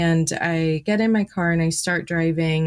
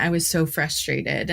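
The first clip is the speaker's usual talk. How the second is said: ordinary talk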